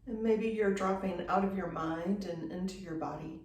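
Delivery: unhurried yoga-teacher meditation voice, long pauses between phrases; guided meditation. Real, not acted. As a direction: guide them gently into stillness, leaving space after each phrase. Leads guided breathing meditation.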